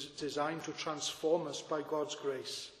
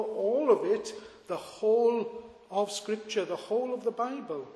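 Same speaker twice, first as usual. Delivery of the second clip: rising, louder sermon emphasis; preaching; 3 semitones higher, 5 dB louder than usual. Acted. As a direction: preach it with building force. Preaching at full force.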